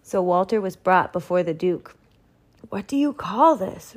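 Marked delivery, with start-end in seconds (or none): 2.7-3.9 raised-pitch indignant demand; a moderately heated roar of disbelief; angry roar. A narrator's reading. Duke confronting the baker, angry roar. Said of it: "roared the Duke".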